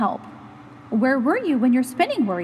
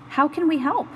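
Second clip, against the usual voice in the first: ordinary delivery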